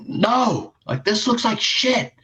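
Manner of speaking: very high-pitched mock outrage; imitated outraged dad. Son retells dad looking out at the mowed lawn, imitated outrage. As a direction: send it way up, imitating an appalled dad.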